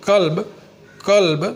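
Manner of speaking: کلب said out loud and clearly; emphatic demo word. Shown as a demonstration. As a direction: present the word کلب to the class. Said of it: "لفظ اردو میں لکھنا ہے".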